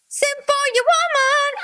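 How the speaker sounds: very high, mock-singing voice; put-on falsetto. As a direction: send it way up into falsetto.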